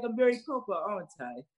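high, mock-proper English accent. Teasing a London fan, English accent. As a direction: put on a prim English accent. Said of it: "started doing an English accent".

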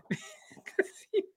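laughing. Real, not acted. Still laughing at the hiccups.

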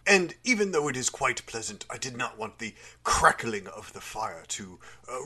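normal talking voice

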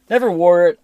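high, loud NEVER; emphatic. Real, not acted. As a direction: punch out never wore it. Insists he never wore mask.

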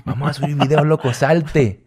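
coaxing the friend to quit university; tempting devil voice. Retold or imitated. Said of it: "así le dije".

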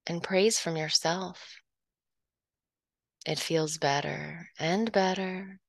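usual speaking voice

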